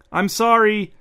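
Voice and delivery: high, loud apology from another room; called-out apology. A narrator's reading. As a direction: call the apology ahead of you as you hurry in. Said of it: "The apology preceded the woman issuing the apology".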